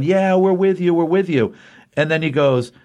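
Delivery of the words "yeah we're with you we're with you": high, keen mock chorus of yes-men; eager agreement. Workers eagerly assuring the boss, mock-excited. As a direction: chime in eagerly, a touch overdone. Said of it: "everybody is freaking out going".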